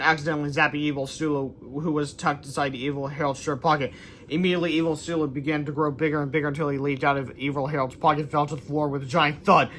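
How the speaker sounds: usual speaking voice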